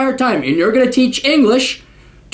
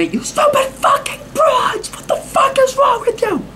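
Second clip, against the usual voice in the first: mock shrill shout. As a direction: shriek it way up high like a hysterical caricature. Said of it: "didn't wanna go in there".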